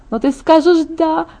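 high, lilting self-satisfaction; pleased singsong. A narrator's reading. Friend sure of the bride's yes, pleased singsong. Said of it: "пропела она довольным голосом".

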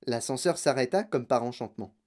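ordinary delivery

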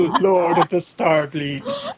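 slow, through laughter; laughing. Real, not acted. Host repeating the line, laughing it out slow. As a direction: savour it while laughing.

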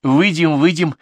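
high, loud, hurried assurance; quick. A narrator's reading. Valentina cuts in to promise the manager, quick. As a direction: jump in eagerly to smooth it over. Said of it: "быстро сказала Валентина".

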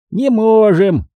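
high gnome echo; high-pitched echoing repeat. A narrator's reading. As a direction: echo it high, drawing out the stress. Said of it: "как эхом повторил Фикс".

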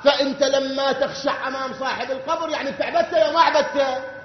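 ordinary delivery

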